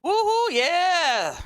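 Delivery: high, drawn-out whoop; long celebratory woohoo; excited cheer. Real, not acted. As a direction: cheer it out, letting "yeah" fall slowly. Cheering on the live stream.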